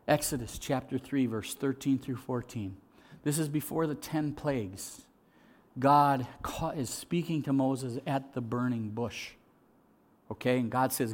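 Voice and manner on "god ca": usual speaking voice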